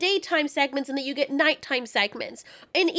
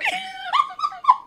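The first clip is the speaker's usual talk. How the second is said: squealing burst of laughter; high shrieking laugh. Real, not acted. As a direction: lose it completely. Cracking up mid-rant.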